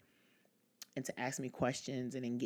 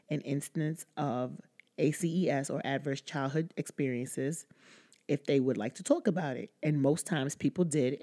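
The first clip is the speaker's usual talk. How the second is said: louder, even read-aloud voice; 6 dB louder than usual. Real, not acted. Reading a friend's message about healing, projected read-aloud voice.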